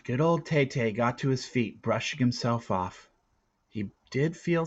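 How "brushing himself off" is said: ordinary delivery, nothing marked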